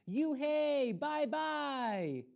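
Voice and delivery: high, loud sing-song taunt; kids yelling. Acted. Bus kids jeering at the new girl, high loud taunting. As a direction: call out like teasing kids. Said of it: "the kids yelled".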